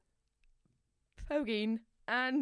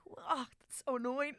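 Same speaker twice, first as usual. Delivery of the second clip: higher, drawn-out mock exasperation; retold annoyance; 6 semitones higher, slower than usual. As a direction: re-enact being annoyed with yourself, slowly. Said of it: "it really annoys me".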